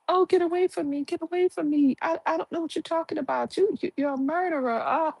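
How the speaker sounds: high, hammed-up frightened shriek; mock screaming. Woman fleeing the diner fakes terror, high mock scream. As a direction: act out a fake panicked scream. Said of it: "she starts screaming and hollering".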